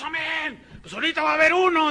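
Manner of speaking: high fast shout of a showman preacher; shouted promise. Retold or imitated. Preacher promises the crowd a miracle, shouting fast and high. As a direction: hype the crowd, fast and loud.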